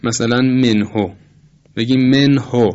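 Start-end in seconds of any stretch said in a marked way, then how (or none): 0.4-1.1 منهو with Persian "e" in the first syllable; faulty منهو, open "e" then "o"; wrong Persian-style vowel. Shown as a demonstration. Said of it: "نه، این درست نیست". 2.1-2.7 منهو pronounced "menho", Persian "e"; firm "menho" for منهو, vowels opened the Persian way; wrong Persian-style vowel. Shown as a demonstration. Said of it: "نه، این درست نیست".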